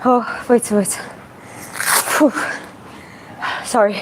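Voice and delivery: winded and unwell; catching breath between words. Real, not acted. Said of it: "I don't feel good".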